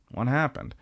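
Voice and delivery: softly put-on voice for a grungy writer; offhand character voice, softly blurted. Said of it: "I like your voice for him".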